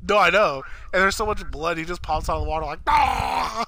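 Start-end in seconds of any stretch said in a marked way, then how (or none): 2.9-3.6 high yelp; short hammed-up shriek; mock scream. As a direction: yelp as if bursting out of water. Character popping out screaming.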